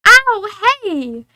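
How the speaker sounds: high, loud yelp; startled ouch. Acted. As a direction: yelp at a sudden scratch. Cat scratches in story.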